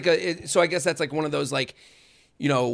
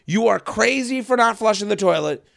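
ordinary talk

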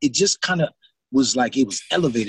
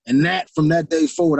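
normal talking voice

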